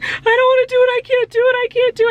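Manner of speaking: high, loud tearful wail; sobbing. Retold or imitated. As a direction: re-enact the panicked crying. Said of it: "I literally was sobbing after she left".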